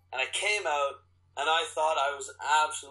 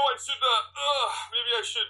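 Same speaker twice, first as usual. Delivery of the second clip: nervous pleading; 7 semitones higher than usual. Retold or imitated. Retold nervous pleading at front desk.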